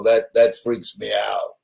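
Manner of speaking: ordinary delivery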